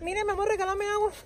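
high-pitched put-on woman's voice; imitated woman's voice. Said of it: "Imitando voz de mujer". (imitando voz de mujer, aguda)

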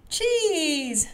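high, long falling cheer; excited high-pitched storybook cheer. A narrator's reading. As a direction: sing out a gleeful cheer. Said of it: "Mandy cheered again".

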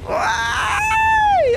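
sudden sharp high yelp of alarm; a real yelp when the tank nearly flips; loud high-pitched "ay" of fright; startled shout. Real, not acted. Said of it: "Ahí grité".

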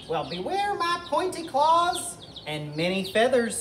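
high-pitched plucky hen retort; defiant chicken. Acted. Edna the chicken threatens Tyrannosaurus back, high defiant voice. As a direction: answer the threat in a high little voice.

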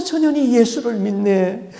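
high wailing line rising at the end; the demon bewailing her faith; lament. Retold or imitated. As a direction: moan it in disbelief. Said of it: "막 울면서 통곡을 하는데".

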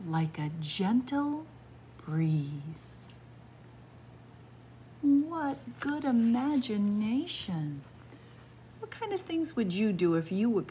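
ordinary delivery, nothing marked

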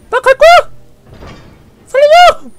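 high loud cry for help; panicked shout. Real, not acted. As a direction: scream for rescue in panic. (다급한 비명)

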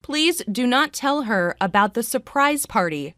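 ordinary talk, nothing marked

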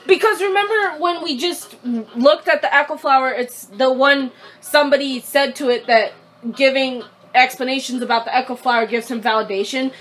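normal talking voice

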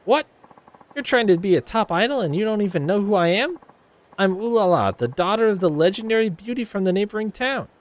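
high, self-important boast; haughty Ulala bragging about herself; vain rival idol. Acted. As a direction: show off who you are.

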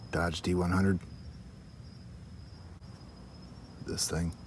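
hushed late-night voice; whispering. Real, not acted. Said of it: "Sorry about the whisper".

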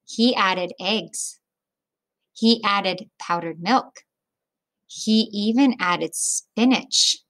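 put-on reading a picture book aloud to children; children's story read-aloud; storybook narration. A narrator's reading. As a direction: tell it gently, like story time.

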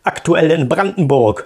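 smug put-on voice; bragging viewer. A viewer bragging about being the nicest in a smug put-on voice. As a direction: brag like a show-off.

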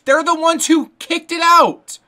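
loud, high, indignant disbelief; yelling at the screen in outrage; frustrated outburst. Real, not acted. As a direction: protest the ref's call at full volume. Furious at game's call.

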